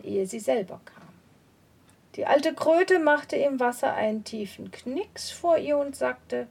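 usual speaking voice

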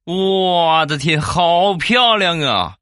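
high, slow gushing praise in the wife's voice. Retold or imitated. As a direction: gush over the dress, drawn out. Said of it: "我媳妇儿当时就说".

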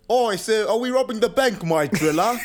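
high-pitched fake British lad; badly faked British slang voice; mock British accent. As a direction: ham up a terrible British accent, breaking into laughter. Mock British accent bit resumed.

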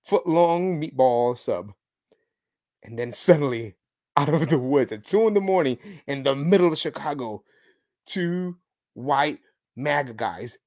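ordinary delivery

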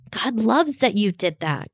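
ordinary delivery

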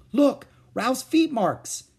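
put-on high, slow child's exclamation; a small child showing off her picture; little girl exclaiming. A narrator's reading. Little sister exclaiming high to Beezus. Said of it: "exclaimed Ramona".

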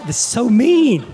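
high, loud mock protest; hammed-up gasp of disapproval; mock horrified listener. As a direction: play the shocked audience, loud and high. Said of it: "you should have just been like".

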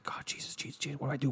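breathless whispered panic in a school bathroom; retold, whispered "Jesus, Jesus" in a fluster; panicked whisper. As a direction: hiss the names under your breath, trying not to be heard. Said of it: "I probably was like".

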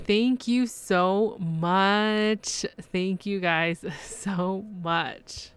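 very slow, drawn-out thank-you; heartfelt thanks. Real, not acted. Host thanking listeners for their support, slow and heartfelt. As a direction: stretch out each word of thanks, a small laugh slipping in.

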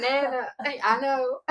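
high-pitched exasperated protest; mock exasperation. Grandchild answering nana's constant catchphrase, playfully exasperated. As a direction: cut in on her in playful exasperation. Retold exasperated reply to Nana.

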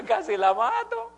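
slow, high, loud laughing exclamation; slow high laughing yell; laughing shout. Real, not acted. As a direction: crack up as you land the punchline. Laughing punchline about the woman.

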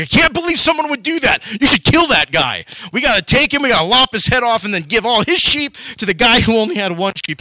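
high, loud furious rant; enraged outburst. Retold or imitated. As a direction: explode in outrage at the injustice. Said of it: "he's just ticked off".

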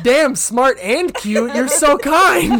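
fake-sweet sarcasm with a laugh; sarcastic sweetness. Comedian replies to the match with mock sweetness.

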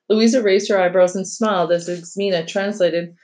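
ordinary delivery, nothing marked

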